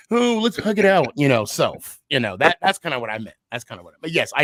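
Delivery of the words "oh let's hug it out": high, gushing buddy voice; annoying frat bro. Retold or imitated. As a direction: overeager hug-it-out fan. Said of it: "my annoying ... self".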